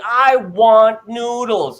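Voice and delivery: the son finally asking for noodles; child's voice. Retold or imitated. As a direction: say it like a small child trying hard. Child finally speaks full sentence.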